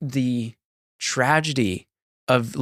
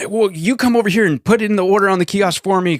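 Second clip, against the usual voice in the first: higher, louder bossy demand; mock demanding customer; 8 semitones higher, 4 dB louder than usual. Entitled customer bossing the cashier, mock demanding. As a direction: order the worker around like an entitled customer. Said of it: "they're like".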